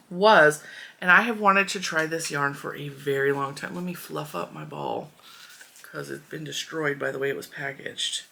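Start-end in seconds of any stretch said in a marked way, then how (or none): none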